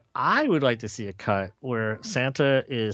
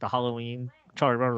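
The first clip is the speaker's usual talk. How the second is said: ordinary delivery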